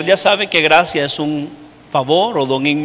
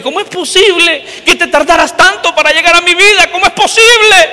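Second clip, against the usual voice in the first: indignant plea, pitched up; complaining to God; 10 semitones higher than usual. Retold or imitated. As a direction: demand an answer from the Lord. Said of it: "me enojé con el Señor".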